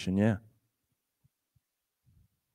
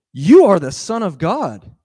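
proclaiming; 6 semitones higher, 9 dB louder than usual. Retold or imitated. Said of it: "Next line".

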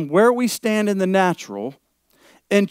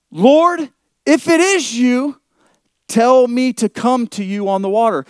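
Peter calling out; 7 semitones higher, 4 dB louder than usual. Acted. Preacher voices Peter calling Jesus.

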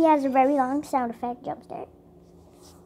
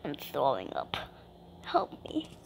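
low, soft mock queasy voice; a hint of a nauseous groan; mock sick voice; 13 dB quieter than usual. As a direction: play a sickened victim, much lower and softer. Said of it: "He smells like".

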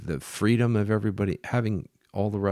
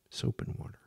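much softer, half-whispered repeat; hushed aside; 3 semitones higher, 10 dB quieter, faster than usual. Real, not acted. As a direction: let it drop almost to a whisper.

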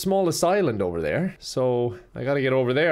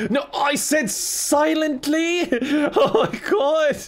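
slow, high laughing yell; exasperated shout; 11 semitones higher, slower than usual. Real, not acted. Stealth ruined, yelling at game.

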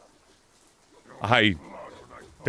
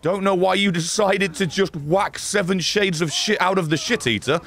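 usual speaking voice

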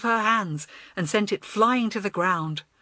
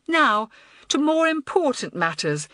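self-important; 7 semitones higher, 4 dB louder than usual. A narrator's reading. Head girl grandly resumes the meeting.

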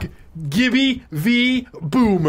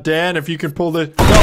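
normal talking voice

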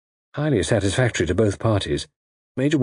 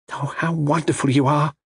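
higher-pitched, admiring heroine; admiring young lady; 6 semitones higher than usual. A narrator's reading. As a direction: gush gently with admiration.

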